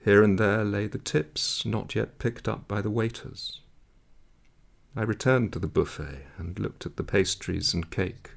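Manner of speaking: ordinary delivery, nothing marked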